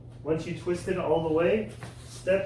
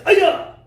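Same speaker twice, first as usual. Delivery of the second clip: very loud piercing high yell; kiai shout; 13 dB louder than usual. Shown as a demonstration. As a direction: explode the yell on the break.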